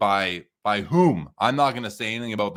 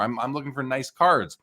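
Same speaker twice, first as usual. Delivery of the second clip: ordinary talk, nothing marked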